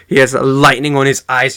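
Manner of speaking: ordinary talk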